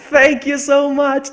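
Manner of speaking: high, loud, slow laughing gratitude; laughing thanks. Real, not acted. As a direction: laugh through a big thank you.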